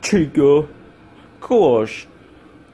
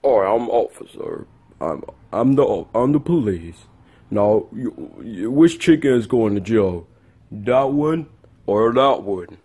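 police officer; 7 semitones lower, 4 dB quieter than usual. Acted.

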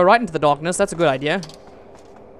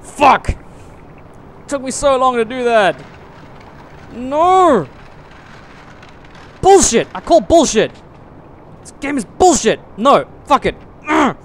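loud, raised-pitch gamer rage; angry outburst; 5 semitones higher, 8 dB louder than usual. Real, not acted. Gamer raging at an unfair game, angry shouting. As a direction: shout it in real frustration. Rages at failed jump.

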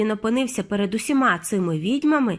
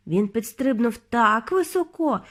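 plain reading voice

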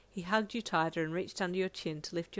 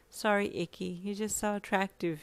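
ordinary delivery

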